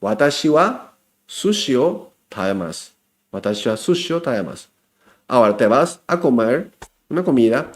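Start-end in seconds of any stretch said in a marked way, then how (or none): 0.0-2.9 careful teacher model read; careful model sentence. Shown as a demonstration. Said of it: "hoy aprendiste que". 3.3-4.7 "Watashi wa sushi o tabemasu" run together fast and a bit softer; fast, casual everyday version; quick natural take. Shown as a demonstration. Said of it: "hoy aprendiste que".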